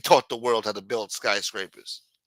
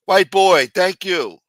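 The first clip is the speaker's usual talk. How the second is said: calling out; 8 semitones higher, 11 dB louder, slower than usual. Real, not acted. Greeting a passerby.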